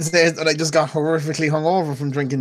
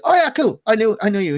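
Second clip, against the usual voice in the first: excited greeting; 6 semitones higher than usual. Retold or imitated. Retelling greeting the barman.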